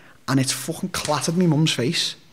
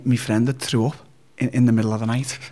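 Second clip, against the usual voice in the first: ordinary talk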